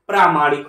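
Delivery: प्रामाणिक with a long first vowel, "praa-maanik"; correct pronunciation. Shown as a demonstration. Teacher giving students the correct word, long first vowel. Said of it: "क्या होता है?".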